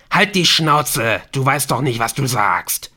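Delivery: Helen screams to shut him up; screaming. A narrator's reading. Helen screams at Ortolan, furious. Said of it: "schrie Helen".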